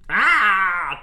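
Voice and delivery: loud, high eagle cry; eagle screech. Acted. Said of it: "That's your eagle impression".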